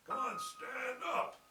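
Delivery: high, slow strained stand up; effortful command. Shown as a demonstration. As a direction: push hard for the effort. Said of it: "You see the difference".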